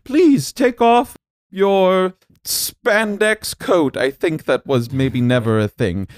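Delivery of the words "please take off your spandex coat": high, slow sing-song host greeting; booming sing song welcome. Acted. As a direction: welcome them like a grand game host. Host greets guests theatrically.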